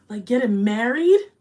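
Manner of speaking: thrilled at the news; drawn-out delighted gasp of a question; excited disbelief. Real, not acted. Reacting to viewer's news.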